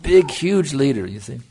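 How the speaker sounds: usual speaking voice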